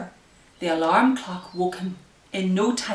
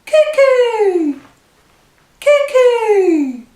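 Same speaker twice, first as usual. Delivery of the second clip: very high, loud cuckoo shout; cuckoo call; 14 semitones higher, 14 dB louder, much slower than usual. Acted. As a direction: call out like the clock's bird popping out. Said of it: "our tick-tock song".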